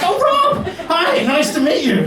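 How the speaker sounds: a thrilled, high-pitched welcome, slowing down; delighted American greeting. Retold or imitated. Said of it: "And they're like".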